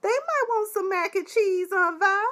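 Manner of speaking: high squeaky mock of Bob's pleading. Mocking Bob begging Aunt Vi for mac and cheese, high. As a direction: whine it up high, poking fun. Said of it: "Bob was like".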